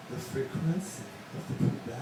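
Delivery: hushed, voiceless mock Pakistani teacher; teacher impression. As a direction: imitate the foreign teacher in a near whisper. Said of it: "do an impression of my first day in class".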